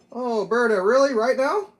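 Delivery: high exasperated pleading; oh come on, at the dog. Real, not acted. Talking to dog who wants out.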